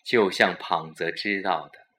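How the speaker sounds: plain reading voice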